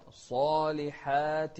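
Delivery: strong measured recitation; heavy sad, light ending. Shown as a demonstration. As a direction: chant الصالحات thick on "saa", thin on "haa". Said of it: "التفخيم في الصاد والترقيق".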